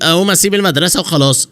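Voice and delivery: ordinary delivery, nothing marked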